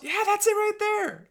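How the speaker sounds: very high, delighted recognition; squeaked-up mock amazement; excited customer. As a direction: imitate a customer thrilled to spot their house. Acted customer seeing own house.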